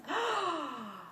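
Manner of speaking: high, drawn-out gasp of pleasure; sharp gasp. Shown as a demonstration. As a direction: gasp as if caught by the sensation. Demonstrating the shallow ones.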